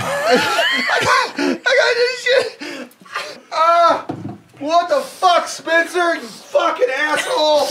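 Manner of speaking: high laugh-crying shout; laughing through tears, high. Real, not acted. Crying from hot pepper.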